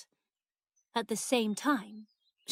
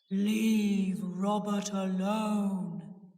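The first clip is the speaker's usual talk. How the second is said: slow, drawn-out deep ghostly voice; deep, heavy warning; 4 dB louder, much slower than usual. A narrator's reading. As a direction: intone it slowly and deep, like a command from beyond. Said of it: "she said in a deep voice".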